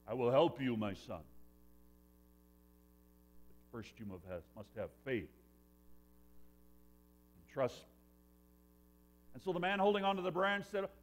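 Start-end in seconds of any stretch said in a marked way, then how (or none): 0.1-7.9 deep voice from above. Acted. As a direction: speak like a calm deep voice echoing through a canyon. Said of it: "this deep voice came through the canyon".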